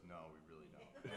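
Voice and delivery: giggling through the answer. Retold or imitated. Gruff Taylor clerk admitting no interest, giggling. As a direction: let the no dissolve into a giggle. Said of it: "the guy giggling goes".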